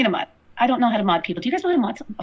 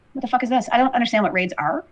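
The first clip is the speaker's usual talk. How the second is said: ordinary talk, nothing marked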